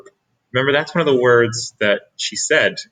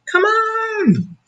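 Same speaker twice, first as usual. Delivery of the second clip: put-on very high, bright "come on" from a little girl; eager child call. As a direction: call out like an impatient kid. Trixie eager to go to school.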